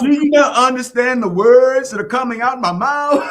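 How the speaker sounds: high, loud, exaggerated yelling; comic full-volume holler; loud mock shout. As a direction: shout it as if they can't hear. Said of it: "Sometimes people are loud because they can't hear".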